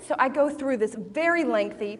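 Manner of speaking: ordinary talk, nothing marked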